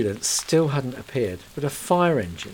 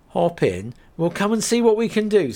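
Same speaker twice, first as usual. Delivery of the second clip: brisk, louder offer of help; lightly voiced driver; 9 semitones higher, 7 dB louder than usual. Driver invites Kate into the cab, briskly. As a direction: practical and ready.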